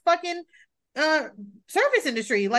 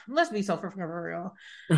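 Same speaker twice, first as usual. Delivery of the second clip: low, soft, stumbling over the words; can't get the words out; tongue-tied; 5 semitones lower, 6 dB quieter than usual. Real, not acted. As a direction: fumble the phrase as your tongue trips. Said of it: "I couldn't even talk".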